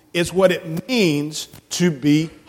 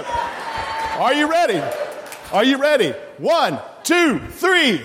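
high-pitched, rousing call to the crowd; hyped-up countdown; 6 semitones higher than usual. Real, not acted. Leader rallying children to be heard in the balcony, hyped-up. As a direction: pump them up for a big shout.

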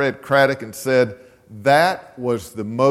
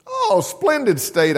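much higher and slow, lightly voiced old man; old man's hearty reply; 13 semitones higher, slower than usual. Old man praising Oklahoma to the guests, slow high hearty voice. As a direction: savour splendid like a genial old gent.

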